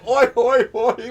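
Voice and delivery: loud, high gorilla-gang yell. Acted. Player voicing gorillas stealing an artifact, yelling. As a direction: holler each oi like a rowdy henchman. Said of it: "I should have them yelling".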